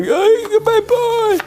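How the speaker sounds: high, singsong bye-bye; a breezy farewell; mock cheerful goodbye. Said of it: "I was like".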